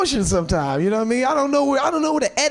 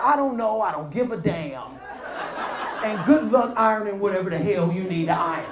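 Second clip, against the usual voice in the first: dismissive; 5 semitones lower, 4 dB quieter than usual. Retold or imitated. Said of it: "She said".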